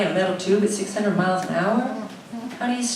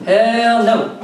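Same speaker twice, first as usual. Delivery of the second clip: emphatic answer; 4 semitones higher, 8 dB louder than usual. Acted. Answering the radio guru.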